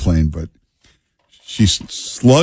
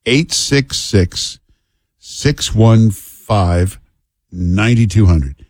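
each digit spaced out and very slow; slow number read; much slower than usual. Shown as a demonstration.

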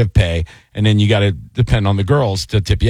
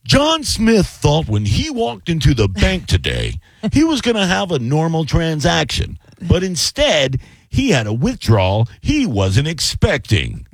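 higher, slow newscaster read; news anchor voice; 4 semitones higher than usual. Shown as a demonstration. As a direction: deliver a pun like a TV anchor. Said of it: "cliche news report".

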